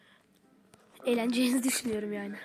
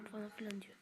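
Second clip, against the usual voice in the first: whispering; 3 semitones lower, 17 dB quieter, faster than usual. Real, not acted. Reading stats.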